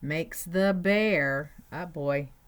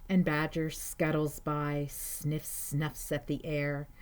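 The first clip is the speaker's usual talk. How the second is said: usual speaking voice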